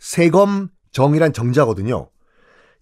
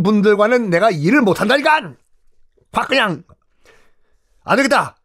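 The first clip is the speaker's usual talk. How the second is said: fed-up general; 7 semitones higher than usual. Acted. General Yi Gwal fed up with the cowardly scholars, angry rant. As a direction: blow up at the timid scholars, then take charge. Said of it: "이괄이 열받은 거예요".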